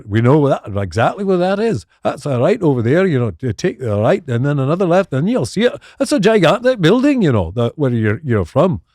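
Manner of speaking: mock Scottish accent, jaunty and a bit high. Glasgow local giving directions to the family, in a mock Scottish accent. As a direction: ham up a friendly Glasgow local. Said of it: "That's my Scottish accent".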